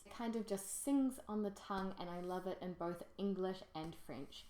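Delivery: ordinary talk, nothing marked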